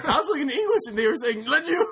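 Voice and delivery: very high, squealed through laughter; cracking up. Real, not acted. Telling friends about a misheard game line, high helpless laughter. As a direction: squeak the story out while laughing.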